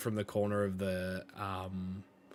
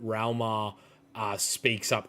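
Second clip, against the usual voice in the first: usual speaking voice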